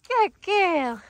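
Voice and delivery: excited dog praise. Real, not acted. Trainer rewards her dog, excited praise. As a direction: gush at the dog.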